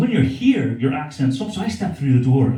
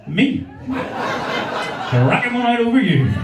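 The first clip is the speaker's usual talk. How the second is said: higher and slow, laughing through it; slow cheeky punchline; 8 semitones higher, much slower than usual. Acted. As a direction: stretch the crude line out, grinning. Crude punchline at audience member.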